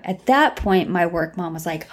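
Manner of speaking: ordinary talk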